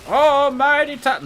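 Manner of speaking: mock grand herald, cut off; booming proclamation. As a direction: announce it like an epic herald. Booming false start of impression.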